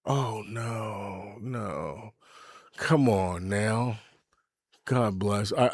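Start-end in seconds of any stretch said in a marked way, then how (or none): 0.0-4.0 son's dismay. Retold or imitated. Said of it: "my son went".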